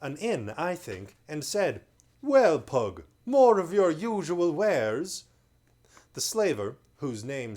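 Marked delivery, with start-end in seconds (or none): 2.2-5.2 loud, slow lordly greeting; haughty lord. A narrator's reading. Bearded lord sneering at the slaver, loud and lordly. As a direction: talk down to the trader.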